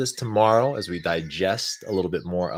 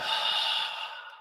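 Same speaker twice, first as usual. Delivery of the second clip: heavy sigh; 5 dB quieter than usual. Shown as a demonstration. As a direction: sigh it all out heavily. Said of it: "Exhale heavy with a sigh".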